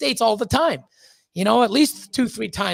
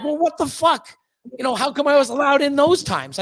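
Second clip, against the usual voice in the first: higher, louder indignant demand; exasperated outburst; 6 semitones higher, 3 dB louder than usual. Retold or imitated. Challenging the border agent, higher and louder, exasperated. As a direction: throw the question back at her, fuming.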